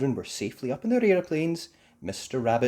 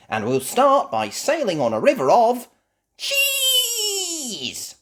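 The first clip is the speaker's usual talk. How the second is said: loud, soaring to a squealed cheese; Miss Rabbit announcing; 9 dB louder, slower than usual. Acted. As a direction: build up to the big reveal. Miss Rabbit announces boat ride.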